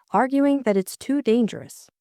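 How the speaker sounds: ordinary talk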